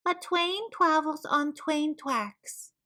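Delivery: slow lisp, every r a w; miserable lisping rodent. Acted. Wadney answering the teasers, slow sad w-for-r lisp. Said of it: "Wadney replied miserably".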